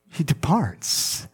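ordinary talk, nothing marked

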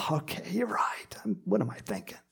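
unvoiced whisper, giving in; timid whispered surrender; meek whisper. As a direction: whisper it like a chastened boy. Meekly agreeing with King Saul.

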